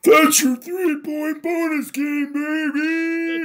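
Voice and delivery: high, loud, drawn-out sportscaster yell; Dick Vitale. Retold or imitated. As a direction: holler it like an excited basketball commentator. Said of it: "We love it when Dick Vitale joins the show".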